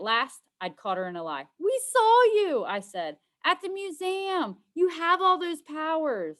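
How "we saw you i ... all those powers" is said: triumphant accusation. Acted.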